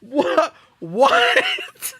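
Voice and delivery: squeaky laughing cry, climbing very high; laughing disbelief. Real, not acted. Streamer baffled by chat, laughing incredulously. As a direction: let the word break up into helpless laughter.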